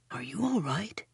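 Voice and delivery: very soft whisper. A narrator's reading. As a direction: whisper it close. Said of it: "the goblin whispers".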